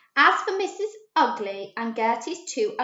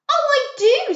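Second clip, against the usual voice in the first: eager; 9 dB louder than usual. A narrator's reading. Gertie eager in story.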